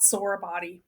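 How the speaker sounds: ordinary delivery, nothing marked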